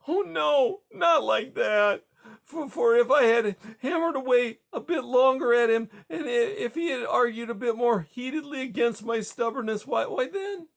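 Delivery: high, wailing sob-laden protest; weeping. Acted. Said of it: "she only wept louder".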